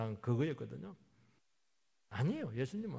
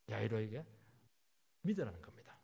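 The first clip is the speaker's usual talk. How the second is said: normal talking voice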